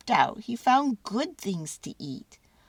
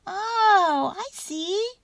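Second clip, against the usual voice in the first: high, squeaky little mouse voice; 12 semitones higher, 5 dB louder, much slower than usual. A narrator's reading. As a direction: squeak it like a tiny mouse catching on. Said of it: "Mortimer squeaked".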